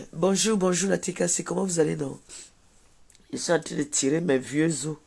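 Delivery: ordinary delivery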